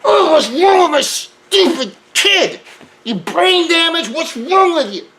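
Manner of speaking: high, loud, slow angry mother; mad mother. Retold or imitated. Mother yelling at her son in her head, high and furious. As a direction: imitate a furious mother berating her kid. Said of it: "Sometimes she's mad".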